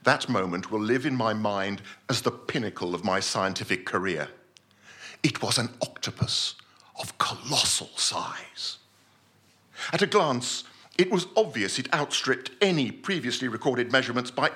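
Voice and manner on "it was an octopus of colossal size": hushed awe. A narrator's reading. Awed reveal of the creature.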